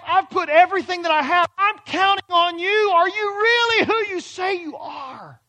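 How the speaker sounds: high, loud anguished plea to God; doubting prayer. Pretend. A doubting believer cries out to God, high and loud. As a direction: beg God for an answer.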